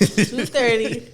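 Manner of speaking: teasing curfew, slow and loud; stretched out teasing. Retold or imitated. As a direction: drag out the time like a warning.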